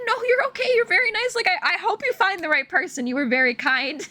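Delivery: imitating her own polite reassurance; retold kind letdown. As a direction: let someone down sweetly. Kindly turning down a suitor.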